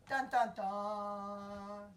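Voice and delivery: slow, low dramatic sting; ominous dun dun dun. Acted. Teller adds spooky suspense music for the kids, slow chant.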